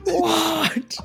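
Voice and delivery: high-pitched comic disbelief; exaggerated high gasp of a word; mock shock. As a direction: squawk it in hammed-up astonishment. Co-host joins in [name]'s shock.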